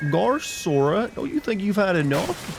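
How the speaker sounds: high, drawn-out cartoon goof; Goofy. Goofy asking Sora if he's had enough, high goofy voice. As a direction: put on a high, loping cartoon voice. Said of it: "No, Goofy".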